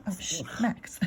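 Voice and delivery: ordinary delivery, nothing marked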